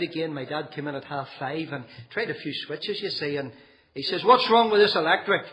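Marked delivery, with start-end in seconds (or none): 4.2-5.4 higher and louder, demanding; angry father. Retold or imitated. Father to son, angrily demanding about the dead lights. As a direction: bark it like a dad finding the lights dead. Father finds power cut.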